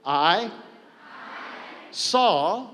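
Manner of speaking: "I" and a long pause, then "saw" pitched high; teacher turning "Asami" into "I saw"; split memory aid. Shown as a demonstration. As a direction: break "I saw" in two and lift the "saw". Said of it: "It's just a memory aid".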